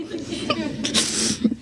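breathy laugh mixed with crying; teary laugh. Real, not acted. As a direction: laugh through the tears.

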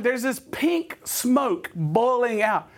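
ordinary talk

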